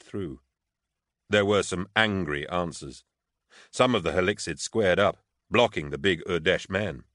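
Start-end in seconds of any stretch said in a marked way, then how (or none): none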